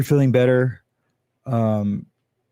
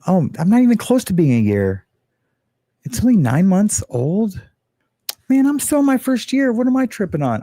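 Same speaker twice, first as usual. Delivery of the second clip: surprised; 9 semitones higher than usual. Real, not acted. Surprised channel is nine months.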